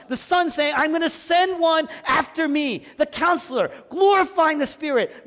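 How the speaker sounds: high, loud, building sermon climax; full-force preaching. Acted. Pastor preaching the Trinity loudly to the congregation. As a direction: preach it with rising fire.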